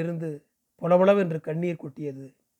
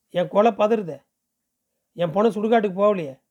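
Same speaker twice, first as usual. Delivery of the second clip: ordinary delivery, nothing marked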